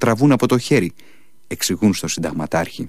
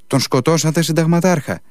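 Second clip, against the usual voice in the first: raised, higher cry at the colonel; shouting; 7 semitones higher than usual. A narrator's reading. The monk cries out to the colonel about the killing. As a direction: call out in alarm, pitched high. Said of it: "φωνάζει ο προσφοράρης".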